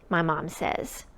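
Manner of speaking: ordinary delivery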